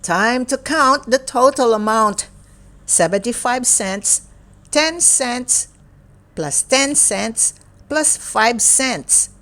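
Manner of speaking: lively, sing-song picture book reading; reading to children. Acted. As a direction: read it brightly to a young child. Picture book read aloud.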